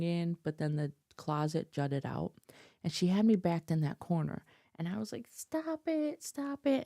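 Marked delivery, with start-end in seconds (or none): 5.3-6.8 high, whining stop it; whiny crying plea. Retold or imitated. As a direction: whine it like a crying kid. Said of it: "whining and crying and boo-hooing".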